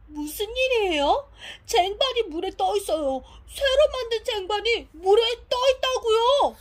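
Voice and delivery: 높고 들뜬 큰 목소리로; 믿기지 않는 발견을 외치듯; 놀라서 흥분. Acted. 놀라운 발견에 흥분해 외침. (흥분해서 소리치는 말투)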